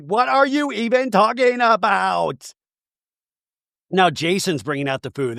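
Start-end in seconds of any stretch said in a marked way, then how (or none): 0.0-2.5 high, drawn-out imitation; mock exasperated cry. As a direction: stretch it into a hammed-up protest. Retold quarrel.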